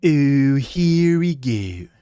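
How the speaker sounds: slow, drawn-out mock announcer; echoing the clip. Streamer echoing the Stewie clip, slow mock imitation. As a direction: stretch it out like the clip does.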